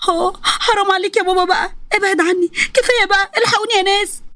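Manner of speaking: high, crying plea for help; pleading cry. Acted. Daughter cries and begs her father to stop beating her. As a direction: beg him to stop between cries.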